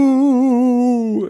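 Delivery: long high frightened ooh; a fearful wavering ooh; scared high ooh. Acted. Twins peeking around the corner, frightened ooh.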